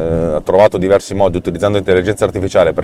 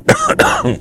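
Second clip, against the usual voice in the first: chesty cough. Real, not acted. Cough mid-sentence.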